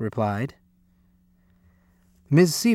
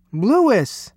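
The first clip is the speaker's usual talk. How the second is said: high, startled call of a name; shocked cry; 8 semitones higher, 4 dB louder than usual. A narrator's reading. Parents shocked by the construction crew, crying out. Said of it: "they cried".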